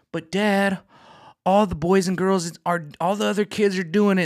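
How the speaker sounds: put-on high pleading kid voice; whiny kid. Son begging dad in an after-school special, high and pleading.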